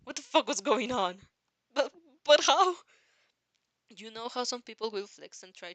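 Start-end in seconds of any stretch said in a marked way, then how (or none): 1.7-2.8 loud, high, slowed stammer; shocked disbelief. A narrator's reading. Listener stunned by All Might's true form. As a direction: gasp out the question in shock.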